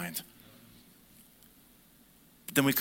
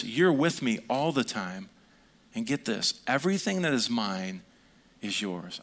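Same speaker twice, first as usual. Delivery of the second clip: tender father; 4 semitones lower than usual. Acted. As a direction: hush down like a father comforting his son. Father's tender words to son.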